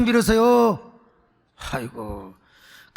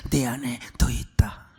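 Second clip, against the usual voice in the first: whispered aside; 10 semitones lower, 4 dB quieter, slower than usual. Acted. Whispered aside about hero.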